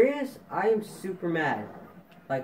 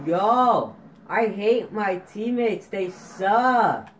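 raised, loud frustration; angry venting; 4 semitones higher, 5 dB louder, much slower than usual. Real, not acted. Gamer venting about teammates, angry and loud. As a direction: burst out in exasperation, pitched up.

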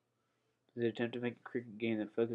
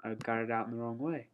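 ordinary delivery

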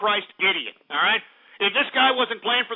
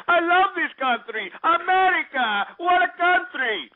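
higher, slow mock Russian comic accent; mock Smirnoff Russian accent; 8 semitones higher than usual. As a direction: ham up a Yakov Smirnoff Russian immigrant. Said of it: "Yakov Smirnoff schmucks".

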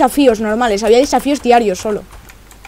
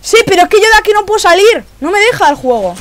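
much higher, louder, exasperated; exasperated complaint; 8 semitones higher, 4 dB louder than usual. Real, not acted. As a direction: protest that you're trapped. Stuck in the game, complaining.

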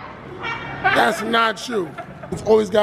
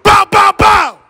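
high, loud shouted pop pop; shouted ad-lib; 10 semitones higher, 11 dB louder, slower than usual. Shown as a demonstration. Host shows a passerby the ad-lib, loud shout. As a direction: bark the ad-libs like a drill rapper. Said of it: "I wanna hear pow".

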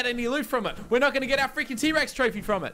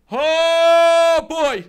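loud groan; 5 semitones higher, 10 dB louder than usual. Real, not acted. Game loading dread.

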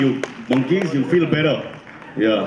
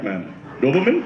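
usual speaking voice

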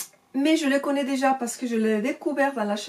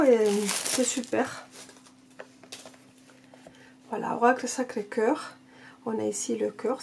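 usual speaking voice

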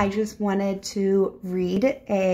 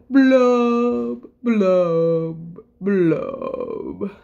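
glum fish blubbing; 4 dB louder, much slower than usual. Acted. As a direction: draw out each blub, sinking down. Gloomy fish's drawn-out blubs.

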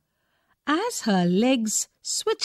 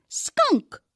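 put-on high, firm child's voice; a small girl insisting; firm little girl; 14 semitones higher than usual. A narrator's reading. Jo firmly picking the animal for Daddy's story. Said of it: "she said firmly".

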